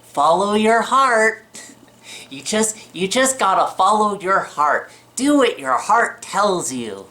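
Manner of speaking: dreamy mock inspirational voice; mocking the world. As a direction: parody the cheesy follow-your-heart slogan. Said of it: "the world's always like".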